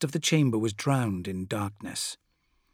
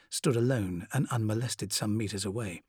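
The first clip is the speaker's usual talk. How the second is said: usual speaking voice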